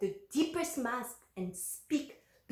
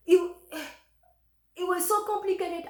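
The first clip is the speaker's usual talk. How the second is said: choked-up distress; 7 semitones higher, 5 dB louder than usual. Real, not acted. Upset while retelling fraud request.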